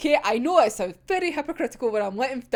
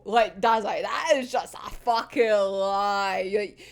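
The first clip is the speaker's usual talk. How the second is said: ordinary delivery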